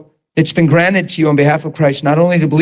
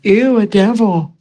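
higher, slow squeamish "Ew"; mock disgust; 6 semitones higher, slower than usual. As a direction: play the squeamish believer. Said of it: "I wasn't like".

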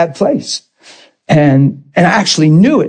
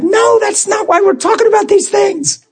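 high, emphatic pulpit cry; full-force preaching; 12 semitones higher than usual. Real, not acted. Pastor correcting the congregation's guilt, high and emphatic.

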